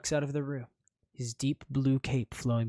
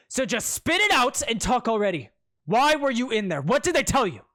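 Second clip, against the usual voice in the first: demanding rival; 9 semitones higher, 9 dB louder than usual. Acted. Rival prince demands answers.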